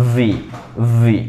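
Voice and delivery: ভি said as English v, lips on teeth; ভি like English vee; demo sound v. Shown as a demonstration. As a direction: voice ভি with a true v. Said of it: "উচ্চারণটা এরকম".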